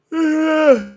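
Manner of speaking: loud, high weary sigh. Real, not acted. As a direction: let out a long high sigh.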